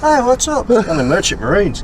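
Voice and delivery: high, loud mock Aussie sailors; Australian accent. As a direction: voice the rowdy merchant marines, Aussie style. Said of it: "the Australian accent you do".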